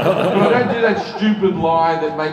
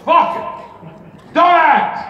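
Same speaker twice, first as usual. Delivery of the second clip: higher and louder mock Pacino rant; hammed-up Pacino, higher and louder; mock Pacino outburst; 9 semitones higher, 6 dB louder than usual. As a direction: bark it like a fired-up old actor. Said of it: "he goes like this".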